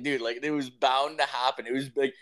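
usual speaking voice

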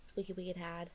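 very soft Boston accent. Shown as a demonstration. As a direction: toss it off quietly like a Bostonian. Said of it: "That's more Boston".

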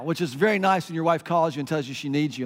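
normal talking voice

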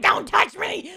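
hammed-up pained warning; retold snapping cry. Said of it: "And I was like".

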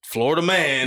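gleeful Florida man announcement; headline announce. Pretend.

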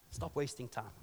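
normal talking voice

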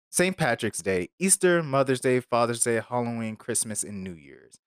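usual speaking voice

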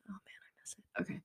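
whispered wistful aside. Real, not acted. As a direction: breathe it out in a longing whisper.

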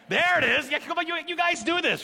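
high, loud burst of excitement; excited approval. Real, not acted. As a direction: cheer the congregation's response, high and loud. Congregation finally responds to his prodding.

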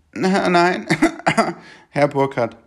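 high, laughing policewoman correction. Retold or imitated. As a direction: pitch it way up as the amused officer. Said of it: "policewoman correcting the name". (hoch und lachend als Polizistin)

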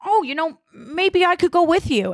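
high, sweet put-on voice; coy scheming girl. Kate angling to join Nick, coy and high-pitched. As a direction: play innocent while scheming.

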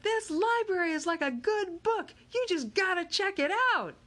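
high, loud playful pitch; Miguel's joking voice. Acted. Miguel joking to the crowd, high playful shout. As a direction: sell the pun to the crowd.

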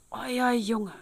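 high-pitched, distressed call; agitated outcry of an upset wife; crying out. A narrator's reading. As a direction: wail an accusation. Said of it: "eilte dann mit lautem Geschrei".

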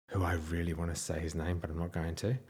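low, soft monotone aside; restrained aside. Real, not acted. Teller to audience about an unnamed manager, restrained aside. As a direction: drop low and soft, holding yourself back. Holding back from naming him.

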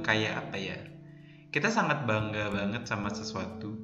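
ordinary delivery

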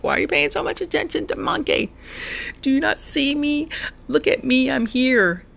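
a mock-jealous little sister cat demanding to be noticed. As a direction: whine for attention like a jealous cat. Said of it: "trying to understand why she gets a little jealous".

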